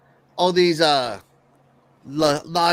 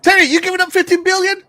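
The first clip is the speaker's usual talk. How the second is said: high, loud teasing question; teasing excited; 11 semitones higher, 5 dB louder, faster than usual. Real, not acted. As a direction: tease him with the question.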